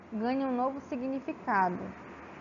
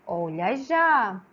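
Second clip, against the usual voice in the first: descrença; 4 dB louder, much slower than usual. Shown as a demonstration. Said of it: "você não acredita".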